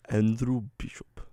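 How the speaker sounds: put-on unconvincing Russian accent; attempted Russian accent. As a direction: have a shaky go at a Russian accent. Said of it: "That didn't sound very Russian".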